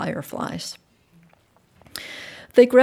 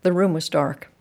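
plain reading voice

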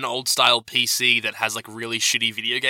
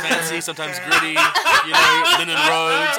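high, laughing impression of Jody; 4 dB louder, slower than usual. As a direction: try out Jody's voice playfully. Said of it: "sounded like Jody".

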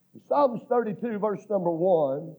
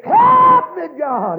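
high, loud, drawn-out plea; crying out; 11 semitones higher, 11 dB louder, much slower than usual. Retold or imitated. Preacher recalling sinking in the pit of sin, high holler. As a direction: soar up high, then drop on the last word. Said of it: "friend, I cried".

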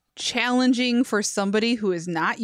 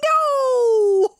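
long, high, loud wail; screamed; 7 dB louder than usual. Retold or imitated. Viewer reacting to interviewer's crude question, screamed in dismay. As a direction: scream it in drawn-out horror. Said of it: "I screamed".

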